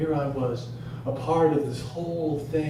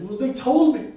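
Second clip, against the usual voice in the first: higher, louder defensive reply; protesting; 10 semitones higher, 5 dB louder than usual. Acted. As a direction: push back at the caller in a raised pitch. Protesting to hospital caller.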